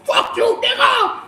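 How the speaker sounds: very high, laughing imitation of a furious caller; mock threat. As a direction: ham up the enraged buyer's threat, slowly. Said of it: "called me ... with all type of".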